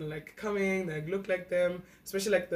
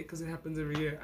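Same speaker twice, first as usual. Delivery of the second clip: usual speaking voice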